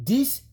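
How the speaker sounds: "This" said in English, high and rising; a high English "This" with a rising tune; English word. Shown as a demonstration. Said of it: "On dit".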